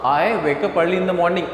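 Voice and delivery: slow, clear teacher model; deliberate classroom drill; slow model sentence. Shown as a demonstration. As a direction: spell it out slowly for the class. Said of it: "जल्दी उठने वाला काम".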